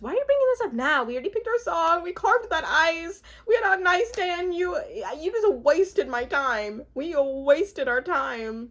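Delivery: hammed-up hurt complaint; upset fiancée.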